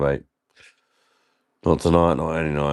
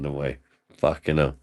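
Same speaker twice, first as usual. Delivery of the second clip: ordinary delivery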